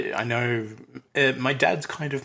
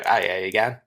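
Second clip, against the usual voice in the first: a hint of thick Aussie accent, lower; broad Australian greeting; 4 semitones lower than usual. Australian abroad greeting a shopkeeper, lightly broad Aussie accent. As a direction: lay the Australian on a little for locals. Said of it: "when you're in the U S, you go".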